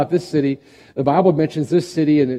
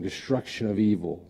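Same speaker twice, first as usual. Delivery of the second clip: normal talking voice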